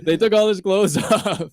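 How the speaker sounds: laughing. Real, not acted. Repeating the punchline laughing.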